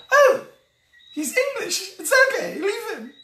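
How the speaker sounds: a high, laughing mock of a dismissive wife. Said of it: "you can say".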